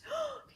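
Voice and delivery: a sharp high gasp; surprised gasp. Acted. Teacher reading to children, gasping as the ant appears. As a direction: gasp in delight at the find.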